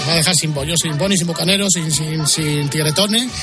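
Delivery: ordinary talk, nothing marked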